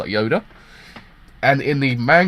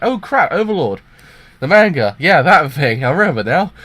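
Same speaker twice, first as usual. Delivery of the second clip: excited, higher, louder realization; sudden realization; 4 semitones higher, 6 dB louder than usual. Retold or imitated. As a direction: blurt it out as it suddenly comes back. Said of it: "I was like".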